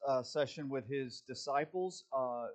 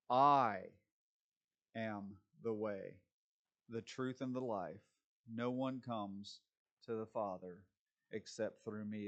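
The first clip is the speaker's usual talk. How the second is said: solemn scripture reading; 5 semitones lower than usual. Acted. Reads Jesus' words in scripture.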